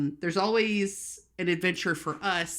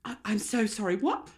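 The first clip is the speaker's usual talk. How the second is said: higher, puzzled apology; imitated confused server; mock incomprehension; 4 semitones higher than usual. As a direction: act politely baffled, rising on "what". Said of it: "look at me like I'm stupid".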